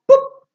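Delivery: "pup" as a single popped p; isolated p sound. Shown as a demonstration. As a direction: pop the lips for "pup", consonant only. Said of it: "cambio por el sonido".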